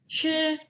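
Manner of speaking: SHI held high and level; first tone. Shown as a demonstration. Teacher modelling Mandarin tones for students, first tone. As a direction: sustain shi on one high, flat pitch. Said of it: "Tone pertama".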